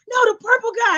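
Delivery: high, loud, insistent correction; grandmother's voice. Retold or imitated. Grandmother correcting her grandchild, imitated impatience. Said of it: "but do the voices".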